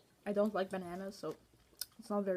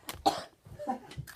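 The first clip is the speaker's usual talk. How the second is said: wasabi cough; 3 dB quieter than usual. Real, not acted. Wasabi hits.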